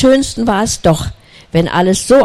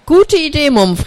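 bright owl voice leaping up high on gute; a young owl delighted by the idea; cheerful owl; 9 semitones higher than usual. Acted. The owl cheering the Mumpf's idea, pitched up high.

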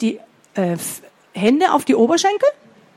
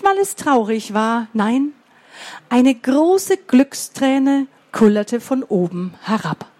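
ordinary delivery

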